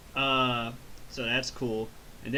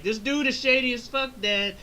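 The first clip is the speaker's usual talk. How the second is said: Trunks whining; 10 semitones higher, 4 dB louder than usual. Retold or imitated. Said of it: "And Trunks is like".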